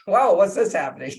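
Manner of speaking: sudden high, loud amazed exclaim. Retold or imitated. Meditator surprised by sudden tears, high loud amazed exclaim. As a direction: caught off guard by his own tears. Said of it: "It was like".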